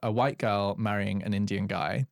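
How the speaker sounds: normal talking voice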